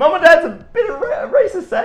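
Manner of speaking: high, loud laughing quip; laughing exclamation. Real, not acted. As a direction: blurt it through laughter.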